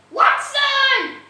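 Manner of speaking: loud high shout of the name; screaming Watson's name. Acted. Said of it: "Sherlock Holmes screamed".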